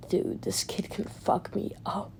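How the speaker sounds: hushed, breathy aside, all whisper; frightened whispered confession; scared whisper. Retold or imitated. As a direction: whisper it like he's afraid of being heard. Garp scared of Luffy.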